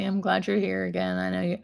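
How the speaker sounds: usual speaking voice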